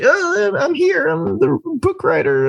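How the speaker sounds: high, slow, wide-eyed innocent voice; mock Elijah Wood. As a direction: play him naively oblivious, a little slow. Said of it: "but he's just like".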